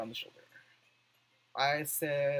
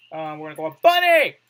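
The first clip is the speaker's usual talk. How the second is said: excited; 17 dB louder, faster than usual. Real, not acted. Excited pick.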